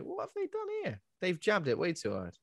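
very high, incredulous squeak; mock outrage at the jab; indignant surprise. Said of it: "I was like".

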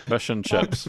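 fish and chips with New Zealand vowels, "fush and chups"; mock New Zealand accent. Host copying co-host's party piece in a New Zealand accent. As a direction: echo a friend's Kiwi accent.

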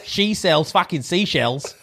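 put-on broad Aussie accent; Australian accent. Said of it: "Australia and say".

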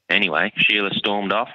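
ordinary talk, nothing marked

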